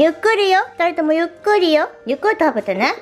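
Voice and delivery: high sing-song praise for the dogs. Real, not acted. Owner praises dogs eating raw meat, high cooing baby talk. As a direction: gush over them like toddlers.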